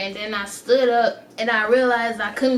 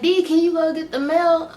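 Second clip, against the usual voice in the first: higher, louder call for the stepson; stepmom calling; 6 semitones higher, 4 dB louder than usual. Retold or imitated. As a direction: play the stepmom asking for the mail.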